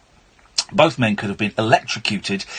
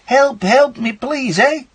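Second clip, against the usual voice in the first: put-on Canadian accent; 12 semitones higher, slower than usual. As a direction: cry for help with a Canadian accent, eh. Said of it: "that's a really good Canadian accent, eh?".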